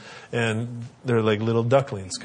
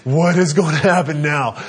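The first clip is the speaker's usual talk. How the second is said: put-on higher, louder, amazed kid voice; wide-eyed kids; 4 semitones higher, 6 dB louder than usual. Kids watch the playground standoff, amazed. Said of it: "they're just".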